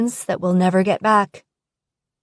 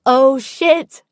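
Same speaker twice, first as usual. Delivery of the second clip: laughing; 6 semitones higher, 4 dB louder than usual. Acted.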